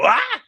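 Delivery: high, loud yelp of a man falling; falling scream. Retold or imitated. Player missing the stairs, sharp high yelp. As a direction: yelp as you tumble down the stairs.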